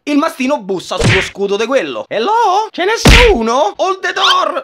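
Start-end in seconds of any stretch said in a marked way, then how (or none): 2.1-4.6 high, hammed-up "anybody home" call; goofy high-pitched calling; mock calling out. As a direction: sing out high like knocking at a door. Knocking on a shield, mock calling.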